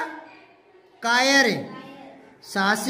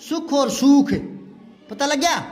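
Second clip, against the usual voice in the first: usual speaking voice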